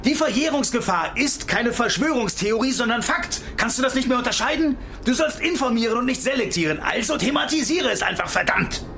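high, heated, indignant rant; angry tirade. Acted. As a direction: lash out in disbelief at her. Streit mit Journalistin über Verjährung. (hoch, empört und wütend)

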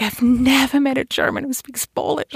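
amazed realtor. Retold or imitated. Realtor to German apartment hunter who spoke Polish, imitated amazement.

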